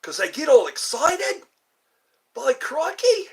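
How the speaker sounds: put-on excited, high and loud Australian accent. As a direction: exclaim it like an over-excited Aussie. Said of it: "I sometimes like the Australian accent".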